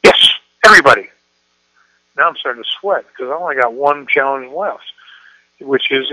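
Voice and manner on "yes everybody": put-on loud, quick teen insistence; insistent daughter. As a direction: fire it back, unshaken. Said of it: "you know what my daughter said".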